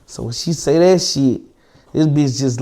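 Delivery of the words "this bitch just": usual speaking voice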